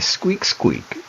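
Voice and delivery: high whispered mock squeak; rat squeak. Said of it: "Normally they just say".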